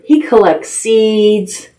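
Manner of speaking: ordinary delivery, nothing marked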